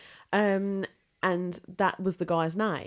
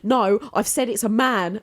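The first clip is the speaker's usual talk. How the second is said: louder, irritated correction; annoyed psychic; 2 semitones higher, 7 dB louder than usual. Retold or imitated. Psychic telling the audience she said a man, annoyed. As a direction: snap back like a fed-up psychic. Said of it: "obviously annoyed and shit".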